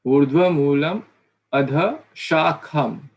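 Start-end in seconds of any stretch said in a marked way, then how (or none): 1.5-3.1 Adha Shakham said slowly, each syllable clear; slow, deliberate reading of Adha Shakham; careful recitation. Shown as a demonstration. Teacher recites the verse words slowly, carefully. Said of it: "This is".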